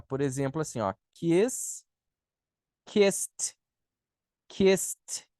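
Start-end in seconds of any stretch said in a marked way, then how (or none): 2.9-5.3 "kissed" said "kist", one syllable; -ed pronounced as T. Shown as a demonstration. Teacher giving an example to students, "kissed" ending in T. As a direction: hiss the S into a clear T in "kissed". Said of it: "daí você tem que pronunciar".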